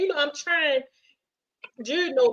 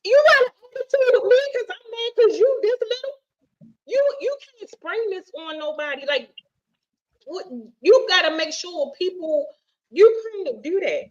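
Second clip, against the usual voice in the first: indignant scolding; 8 semitones higher than usual. Retold or imitated. Telling her date off, high and indignant. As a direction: call him out for lying about height. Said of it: "and I said".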